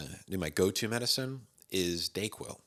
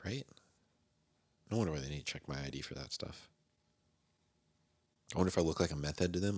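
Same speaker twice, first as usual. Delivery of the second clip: ordinary talk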